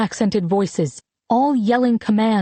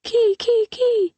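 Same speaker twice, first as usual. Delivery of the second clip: very high little repeated plea; child's whimper; 14 semitones higher, slower than usual. A narrator's reading. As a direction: whimper it over in a tiny, high voice. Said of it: "she whimpered under her breath".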